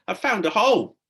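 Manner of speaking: big, high call down the hole; bellowed. A narrator's reading. Tom to Stella, calling from the hole, bellowing. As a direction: shout it out, thrilled at the find. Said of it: "he bellowed".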